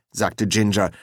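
ordinary delivery, nothing marked

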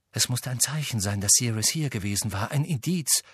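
ordinary delivery, nothing marked

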